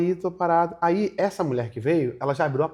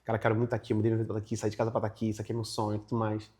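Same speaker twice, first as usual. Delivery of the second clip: low, run-on recital of a prepared plea; lower-pitched rote spiel; rehearsed script; 6 semitones lower, 4 dB quieter, faster than usual. Retold or imitated. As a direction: reel off a memorized pitch. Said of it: "Eu tinha um script já gravado".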